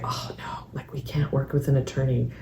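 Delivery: softly voiced reluctant parents, starting in a whisper; hushed worried parents. Clinician quoting parents wary of attorneys, hushed. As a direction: drop to a hushed, confiding quote.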